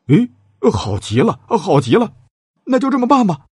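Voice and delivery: clap-along delight building to a high cry; delighted king. A narrator's reading. King approving the beacon plan, gleefully excited. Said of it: "拍着手说".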